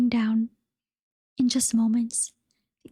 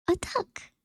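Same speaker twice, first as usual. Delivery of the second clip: put-on high, softer old woman; 7 semitones higher, 4 dB quieter than usual. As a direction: peer down at a duck. Old woman spots duckling.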